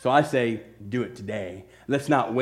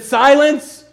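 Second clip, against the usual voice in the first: higher, much louder command to the wind; commanding rebuke; 11 semitones higher, 10 dB louder than usual. Retold or imitated. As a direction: shout down the storm. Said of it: "What did he say to the winds".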